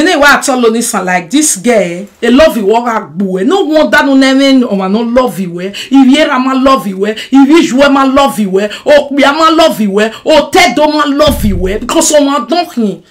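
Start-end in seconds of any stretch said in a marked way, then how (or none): none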